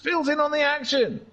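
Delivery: high-pitched racing call; thrilled commentator pitched high; excited commentary. Real, not acted. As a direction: call the action high, falling at the end. Calling overtaking action on track.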